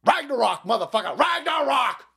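defiant Viking battle shout; Viking war cry. Retold or imitated. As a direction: bellow it like Vikings charging into battle. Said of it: "these Vikings were like".

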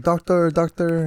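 locals calling for the doctor. Retold or imitated. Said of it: "they would come up to our base and be like".

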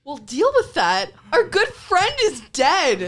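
high, loud, incredulous protest; indignant outburst. Acted. Mouse protesting that a good friend is dead, high loud indignation. Player indignant at a dismissive reply.

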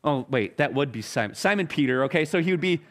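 ordinary talk, nothing marked

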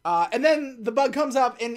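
normal talking voice

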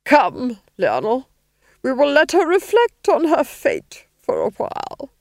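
high, loud, commanding menace; domineering villainess; imperious Duchess. A narrator's reading. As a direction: order the husband away haughtily. Duchess orders her husband away.

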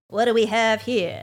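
loud TV detective line; cop cliché line. Retold or imitated. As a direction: play the stock detective arriving.

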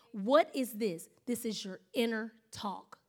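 usual speaking voice

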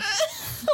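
high squealing laugh; high giggle. Real, not acted. Laughing at intro.

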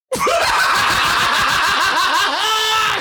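high shouted laugh. Real, not acted. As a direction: howl with laughter. Laughing at the joke.

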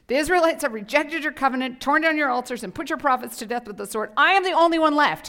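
high, loud whining complaint; whiny Elijah. Acted. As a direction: whine like a self-pitying prophet. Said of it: "read this in a whiny voice".

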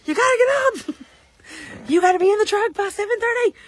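high, loud panicked yell; retold scream. Mom yelling at husband to get up, loud panic. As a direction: yell him awake, late for school. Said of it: "I scream at Mikey".

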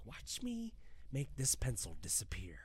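mock menacing whisper, unvoiced; Joker whisper. Friend retelling the Joker scene, whispered. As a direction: breathe the line like a creepy Joker. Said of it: "And he's like".

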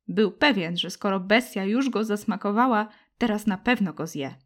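ordinary delivery

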